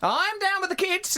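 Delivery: high, insistent comeback; indignant protest. Pretend. Host teased about trying to be down with the kids, indignant.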